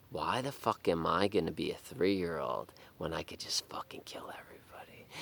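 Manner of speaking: whispered, fading to near silence; sinister whisper. Retold or imitated. The inner psychopath speaking to himself, chilling whisper. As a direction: hiss it like a dark inner voice.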